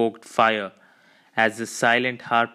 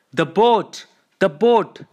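urgent shout, voice pitched up and louder; crying out; 7 semitones higher, 6 dB louder than usual. A narrator's reading. First mate cries out to warn old Ahab, loud and urgent. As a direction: cry out a warning to the captain. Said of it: "cried Starbuck".

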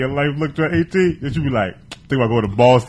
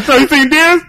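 much higher, louder, goofy voice; mock dopey question; 9 dB louder than usual. Friend mimicking the distracted driver, goofy and high. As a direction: play the clueless driver. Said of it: "he's like".

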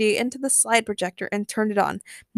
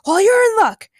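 high, loud burst; excited shout; 13 semitones higher, 9 dB louder than usual. A narrator's reading. Ray offers help to Harley, shouting eagerly. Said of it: "Ray shouted".

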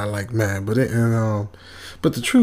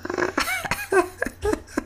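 high eerie laugh out of a horror movie; a high, creepy sleeping laugh; spooky villain cackle; evil cackle; 7 dB quieter than usual. Acted. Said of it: "let out this laugh".

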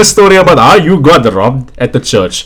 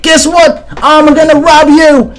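high, slow mock robber; 12 semitones higher, slower than usual. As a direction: ham up a stick-up line. Robber voiced in story.

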